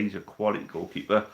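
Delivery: ordinary delivery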